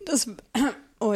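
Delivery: coughing after a swallow gone wrong; choke, clear your throat, recover; choking on a drink. Real, not acted. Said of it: "habe ich mich verschluckt vor Begeisterung".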